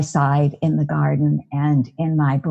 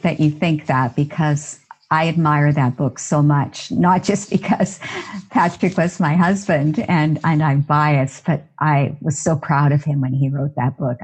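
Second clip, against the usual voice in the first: ordinary talk, nothing marked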